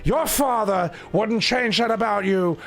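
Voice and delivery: hammed-up actor impression, opening on a shout; Al Pacino. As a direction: swoop high, then fall, with swagger. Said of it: "we'll make him Al Pacino".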